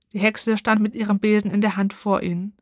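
usual speaking voice

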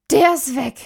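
high, loud, distraught outburst; frantic cry. A narrator's reading. Woman at the window about to collapse, frantic. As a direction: cry out the dreadful news.

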